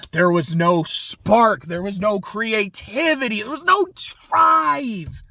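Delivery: drawn-out, mounting villain disappointment; building exasperated rant. Acted. Villain faults his nominee to a boy, mounting exasperation. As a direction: climb in pitch with each phrase, stretching the last word.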